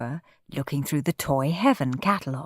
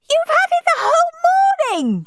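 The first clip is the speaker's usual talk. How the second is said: put-on very high piercing child shriek; shrieking little brother; 5 dB louder than usual. A narrator's reading. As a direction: shriek at Henry in outrage. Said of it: "shrieked Peter".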